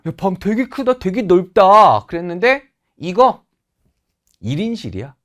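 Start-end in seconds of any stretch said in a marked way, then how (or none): 0.1-2.1 hammed-up wonder, last word loud and drawn out; mock amazement. Teacher acts seeing a huge room, exaggerated amazement. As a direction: act amazed, swelling to a louder, slower finish.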